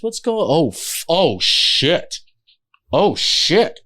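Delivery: drawn-out, dawning shock; shocked realization. Retold or imitated. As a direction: slowly take in the disaster. Manager sees the chaos.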